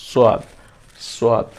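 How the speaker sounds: সোয়াদ with a heavy, rounded swa; rounded lips. Shown as a demonstration. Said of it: "মুখগুলো একটু গোলাকার করে ফেলবো". (ঠোঁট গোল করে সোয়াদ)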